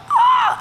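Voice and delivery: high-pitched mock cry of pain; re-enacted agonized "ah"; yell of pain. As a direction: cry out as if hurt.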